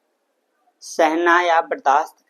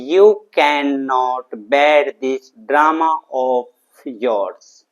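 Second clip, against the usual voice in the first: very slow, each word stretched out and separated; slow model sentence; 3 dB louder, much slower than usual. Shown as a demonstration. English teacher giving students the answer, slowly and deliberately drawn out. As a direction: dictate it word by word for learners.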